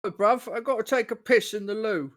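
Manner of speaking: playful Cockney impression for a friend. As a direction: toss it off in a mock London Cockney. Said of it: "was that good enough for you".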